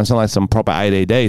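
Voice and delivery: ordinary talk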